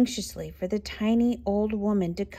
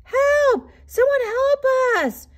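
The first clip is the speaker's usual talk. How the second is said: very high, loud drawn-out cry; panicked wail; 7 dB louder, slower than usual. A narrator's reading. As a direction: wail for rescue at the top of the voice. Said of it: "wailed the animals".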